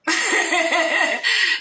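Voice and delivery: high burst of real laughter; long laugh. Real, not acted. Teller laughing at his own tale, a high laugh.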